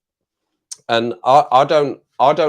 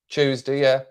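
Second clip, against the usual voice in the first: ordinary talk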